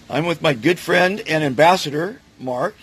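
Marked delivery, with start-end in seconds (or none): none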